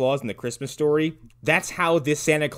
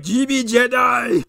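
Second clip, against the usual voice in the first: Jabba the Hutt voice; 10 semitones higher, slower than usual. Retold or imitated. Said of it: "Fucking Jabba-Doo Hut".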